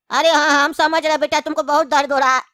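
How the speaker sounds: ordinary talk, nothing marked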